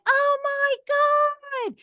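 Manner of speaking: very high, slow, stretched-out squeal; thrilled gasp. Retold or imitated. Greeting a member face to face, high drawn-out delight. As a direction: melt with delight at seeing her. Meeting longtime member in person.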